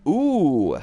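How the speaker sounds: long, loud swooping ooh; high swoop of delight, falling away; excited "ooh". Real, not acted. Streamer hears roommate has the game, excited ooh. Reacts to news of possible teammate.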